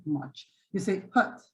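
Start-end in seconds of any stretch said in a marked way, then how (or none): 1.1-1.4 "Hot" with a lax mouth, no tension; relaxed hot. Shown as a demonstration. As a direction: say "Hot" with no effort at all. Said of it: "you don't do anything".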